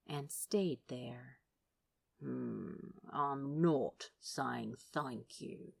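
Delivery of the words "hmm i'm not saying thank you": low, slow mumble into hair; mumbled. A narrator's reading. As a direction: mumble it, muffled and grudging. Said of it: "Crowley mumbled into the soft hair".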